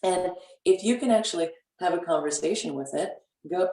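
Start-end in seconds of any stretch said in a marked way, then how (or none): none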